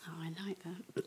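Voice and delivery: very soft whispered reaction; hushed approval. Real, not acted. Listener reacts to the letter just read, hushed whisper. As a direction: whisper it under your breath.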